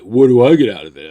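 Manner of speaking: put-on loud, fast girlfriend bargaining; demanding girlfriend. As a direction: fire it back at him quickly. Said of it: "She's like".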